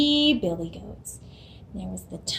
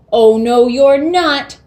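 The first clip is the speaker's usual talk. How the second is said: loud, bold goat shout; defiant goat; 2 semitones higher, 11 dB louder, slower than usual. Acted. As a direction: shout down the troll. Biggest goat defies troll.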